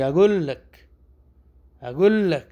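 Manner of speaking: high, loud اقول لک as in "really, I'm telling you!"; disbelieving. Shown as a demonstration. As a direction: insist in disbelief, rising and loud. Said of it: "یقین نہیں آ رہا".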